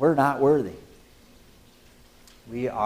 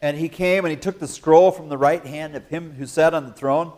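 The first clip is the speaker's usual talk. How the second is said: usual speaking voice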